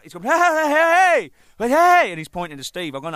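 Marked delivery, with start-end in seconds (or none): 0.2-2.1 high, drawn-out imitated yelling; loud, garbled mock shouting; retold frantic hollering. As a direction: holler wildly to get attention, imitating him. Imitating man shouting unintelligible signals.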